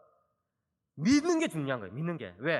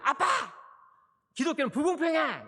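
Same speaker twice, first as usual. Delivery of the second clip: higher mock child's protest; imitating a shouting son; 7 semitones higher than usual. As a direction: play the boy yelling to his dad. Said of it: "큰 소리 지른 거야". (아이 흉내로 따지는 외침)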